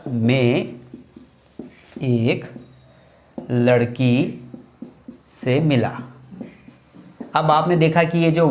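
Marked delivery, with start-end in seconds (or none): 0.1-5.9 each word set apart by long pauses; spaced out for students to write down; slow, word by word. Shown as a demonstration. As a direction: dictate it slowly, one word at a time. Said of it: "अब मैंने वाक्य क्या बोला?".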